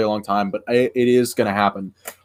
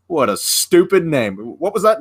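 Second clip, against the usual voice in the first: higher, drawn-out mock disdain; exasperated character; 6 semitones higher than usual. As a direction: act out an annoyed main character, pitched up. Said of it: "it drives this guy crazy".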